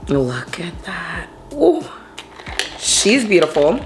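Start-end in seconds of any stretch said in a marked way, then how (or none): none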